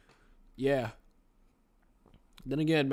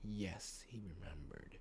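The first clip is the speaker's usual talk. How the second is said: very soft, low whisper; barely audible, hushed reading; 8 semitones lower, 17 dB quieter, slower than usual. Acted. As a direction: whisper the story line. Whispered story reply and narration.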